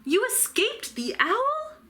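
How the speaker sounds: high, astonished cry; a young bat amazed, pitched high; cried out. A narrator's reading. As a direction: daughter stunned to see her mother. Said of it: "cried Stella Luna".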